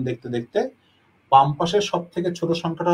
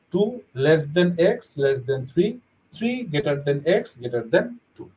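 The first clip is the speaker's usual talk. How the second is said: very slow, word by word; slow demo reading; much slower than usual. Shown as a demonstration. Teacher models the correct left-to-right reading, slowly. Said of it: "এইভাবে আমরা পড়ব".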